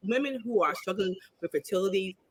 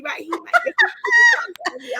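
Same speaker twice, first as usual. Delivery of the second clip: very high words through laughter; high laughing tease; 6 dB louder, faster than usual. Real, not acted. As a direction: squeal it laughing. Laughing while teasing.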